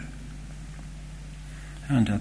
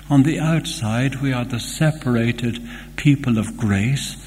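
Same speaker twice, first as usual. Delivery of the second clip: ordinary talk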